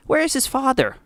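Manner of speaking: high, distressed mother; anguished demand. A narrator's reading. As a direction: ask desperately, pitched well up. Mother demands the absent father.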